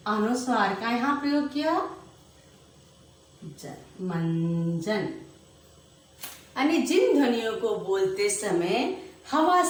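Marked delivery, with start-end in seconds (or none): 3.4-5.1 मंजन drawn out, softer and lower, nasal held; slow soft demo. Shown as a demonstration. Teacher sounding out the word for pupils, soft and slow. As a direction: hum जन then मंजन slowly. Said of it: "अनुस्वार यहाँ लगा दिया".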